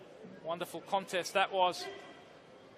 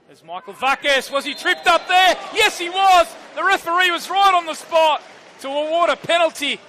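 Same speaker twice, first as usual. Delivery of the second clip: excited penalty call; 3 semitones higher, 8 dB louder than usual. Real, not acted. Commentator calling a penalty to the crowd, excited and loud. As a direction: call the penalty like it's happening right now.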